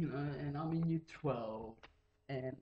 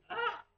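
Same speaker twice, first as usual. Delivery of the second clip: mock startled yelp. Startled by stranger.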